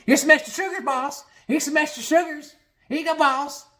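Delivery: very high, squealed eagerness; mock suck-up proudly delivering to the boss; fawning Bobby.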